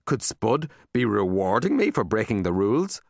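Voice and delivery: usual speaking voice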